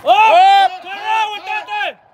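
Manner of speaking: yelling at the runner, slow and loud; excited shout. Real, not acted. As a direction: shout across the field. Cheering a play in a game.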